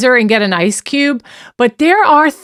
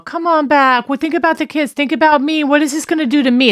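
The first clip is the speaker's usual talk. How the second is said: higher-pitched mock pleading; imitated manipulative abuser; 6 semitones higher than usual. Abuser begging the victim to come back, mock pleading. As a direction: plead self-centredly in a raised, wheedling pitch. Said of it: "commonplace for an abuser to be like".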